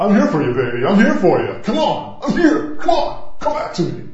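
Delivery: high, loud mock-desperate pleading; over-enthusiastic pleading. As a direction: beg them back with hammed-up gusto. Mock over-eager plea, acting out enthusiasm.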